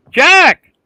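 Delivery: high, full shout for someone downstairs; calling out. Acted. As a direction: yell the name down into the cellar. Wife yells down a second time.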